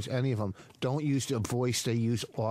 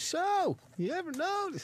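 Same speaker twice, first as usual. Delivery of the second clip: high-pitched punchline comic voice; high Seinfeld voice; slower than usual. Retold or imitated. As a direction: squeak it like an eighties comic. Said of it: "talked with a high Seinfeld voice".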